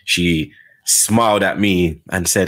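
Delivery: normal talking voice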